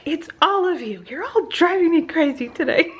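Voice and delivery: laughing through the words, pitched high; re-live the hysterical laugh while telling them off; retold hysterical laugh. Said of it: "I was laughing hysterically".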